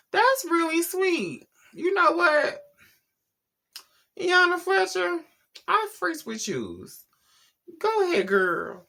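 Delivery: high, slow sassy cheer; high put-on voice hyping up the girlfriend; sassy hype girl.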